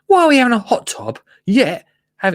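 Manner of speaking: high-pitched, hurried mock complaint; mock Richard Suckett. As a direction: imitate a fuming man in a silly high voice. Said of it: "he doesn't speak like this".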